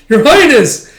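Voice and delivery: very high, loud exclamation; alarmed cry. Retold or imitated. Companion seeing Yona fall over, alarmed. Alarmed second call as she falls.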